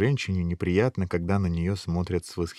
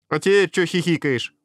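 high, louder puzzled challenge; surprised; 9 semitones higher, 5 dB louder than usual. A narrator's reading. Princess puzzled by the prince's sudden change, surprised. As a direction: demand to know, taken aback. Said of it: "удивилась принцесса".

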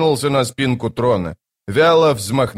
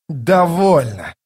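loud, slow, raised growl; growled command; 6 semitones higher, 4 dB louder, slower than usual. A narrator's reading. Draconus interrupts the boasting king, growling angrily. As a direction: cut him off with an angry, drawn-out command. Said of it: "прорычал Драконус".

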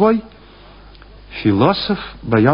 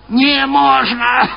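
high, drawn-out crone's refusal; grumbling old woman; 10 semitones higher, much slower than usual. A narrator's reading. Old woman turning the students away, grumbling. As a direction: refuse in a cranky, slow old voice. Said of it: "проворчала старуха".

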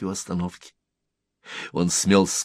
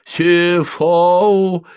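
loud, high garbled shout; threatening sleeper's cry; 11 semitones higher, 9 dB louder than usual. A narrator's reading. Sleeper scaring off intruders who disturb his sleep, threatening cry. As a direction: shout nonsense menacingly through sleep. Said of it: "угрожающе вскрикнул спящий".

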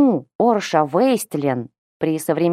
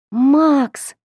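sobbing cry of his name, higher and louder; breaking voice; 8 semitones higher, 4 dB louder than usual. A narrator's reading. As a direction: voice cracking into a sob. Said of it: "Срывающимся голосом всхлипнула она".

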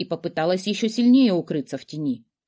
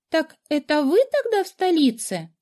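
princess voice; 10 semitones higher than usual. A narrator's reading. Princess recognizing the necromancer.

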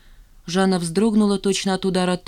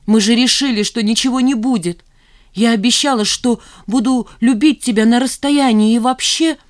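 high, loud, flustered protest; agitated; 6 semitones higher, 7 dB louder than usual. A narrator's reading. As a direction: protest anxiously, raised voice, words running out. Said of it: "От волнения она не находила нужных слов". (взволнованно, повышенным голосом)

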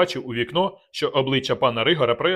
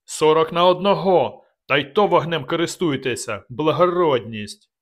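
loud, slow, higher furious bellow; enraged roar; 4 semitones higher, 5 dB louder than usual. Acted. Dubatovk roaring scorn at the attackers' numbers. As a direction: roar out the insult. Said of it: "почувся рев".

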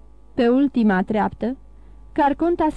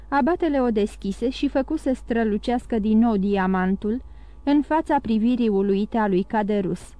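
ordinary delivery, nothing marked

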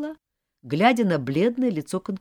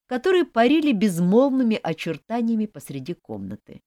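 usual speaking voice

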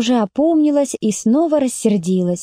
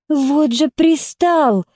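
exasperated; 4 semitones higher, much slower than usual. A narrator's reading. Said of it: "в отчаянии произнесла Катя".

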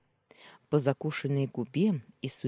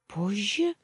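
"Позже?" higher with a rising, unsure tune; bleated question; 7 semitones higher than usual. Acted. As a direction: echo "Позже?" in nervous disbelief. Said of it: "проблеяла сестра".

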